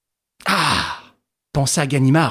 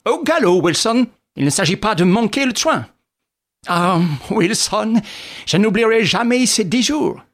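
brisk, cheery raised voice; lively, upbeat and a little higher; joyful; 7 semitones higher than usual. A narrator's reading. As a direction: crow happily to a companion. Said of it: "L'autre semblait joyeux".

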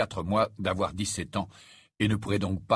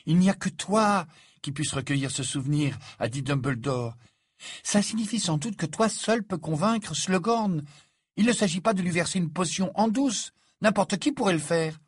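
put-on higher-pitched voice for an insistent girl; earnest Hermione, insisting; 8 semitones higher than usual. A narrator's reading. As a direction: lecture a friend in a raised pitch. Narrator voices Hermione lecturing Harry.

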